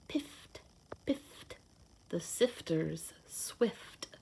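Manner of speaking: slow, soft storybook whisper. Acted. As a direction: hush it out slowly for the kids.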